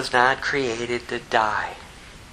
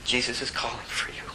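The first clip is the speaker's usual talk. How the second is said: hushed message; 2 semitones lower, 10 dB quieter, faster than usual. Acted. Quoting Martha's message to Mary.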